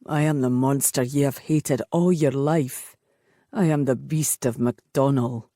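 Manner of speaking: low, grim man's voice; brooding warrior naming his curse; tormented beast of a man. A narrator's reading. Bane's voice continues.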